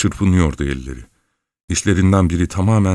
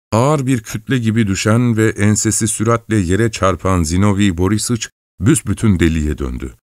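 ordinary delivery, nothing marked

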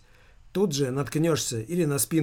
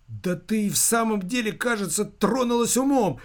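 high, agitated cry; full-voiced shout; 6 semitones higher than usual. A narrator's reading. Son tells mother she is mad, crying out. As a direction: cry out in exasperation, pitch raised. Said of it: "закричал он во весь голос".

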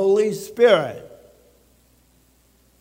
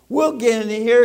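ordinary talk, nothing marked

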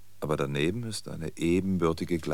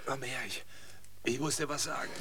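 higher, soft, effortful words; dying speech; 4 semitones higher, 4 dB quieter than usual. Acted. Dying old man has something to tell, strained. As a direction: struggle to get the words out.

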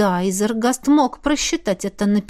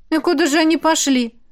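higher, lightly casual question; feigned indifference; 7 semitones higher than usual. A narrator's reading. Woman asking where the rival went, indifferent. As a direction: ask as if it hardly matters. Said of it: "равнодушно спросила она".

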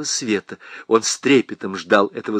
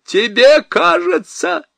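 much higher, louder, slow mocking shout; 5 dB louder, slower than usual. A narrator's reading. Husband shouts back at his wife. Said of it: "закричал он".

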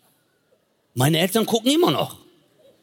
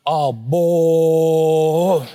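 put-on louder impressed groan of a teenager; mock awed gasp; 5 dB louder than usual. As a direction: drool over the screen. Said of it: "ich so".

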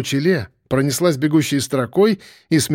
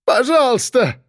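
high, desperate begging cry; yelled plea; 9 semitones higher than usual. A narrator's reading. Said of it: "заорал Рубенчик".

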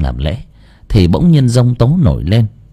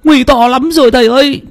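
frantic high-pitched cry for the teacher; shouting while running. Acted. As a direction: call out in alarm, pitched way up. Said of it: "vừa chạy nó vừa kêu to".

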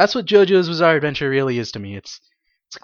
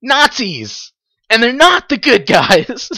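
loud, higher whoop of glee; excited cheer; 6 semitones higher, 4 dB louder, slower than usual. Retold or imitated. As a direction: cheer like a fan. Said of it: "so excited".

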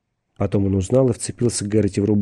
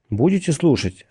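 mildly hurt, higher-pitched question; offended question; 6 semitones higher than usual. A narrator's reading. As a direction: ask it with a touch of offence. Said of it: "обиженно спросил Гэрроти". (слегка обиженно, повыше)

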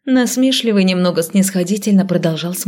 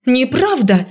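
higher indignant protest; 6 semitones higher, slower than usual. A narrator's reading. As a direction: protest hotly. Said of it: "возмутилась я".

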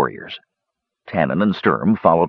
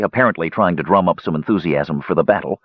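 usual speaking voice

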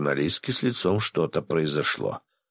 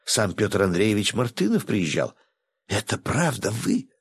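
rattling on excitedly; 8 semitones higher than usual. Acted. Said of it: "затараторила девушка".

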